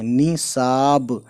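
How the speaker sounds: निसाब drawn out for learners; slow careful pronunciation. Shown as a demonstration. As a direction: say निसाब very slowly with a falling end. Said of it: "नून पे जेर है".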